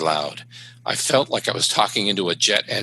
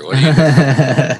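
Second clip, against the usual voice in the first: exasperated shout; 5 semitones higher, 10 dB louder, slower than usual. Retold or imitated. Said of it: "repeating in desperation".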